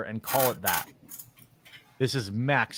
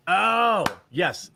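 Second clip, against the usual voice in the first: mock outrage; 7 semitones higher, 5 dB louder than usual. Real, not acted. Mock disgust at Clamato.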